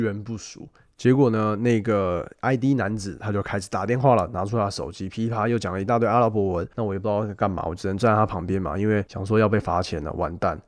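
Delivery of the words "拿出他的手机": ordinary delivery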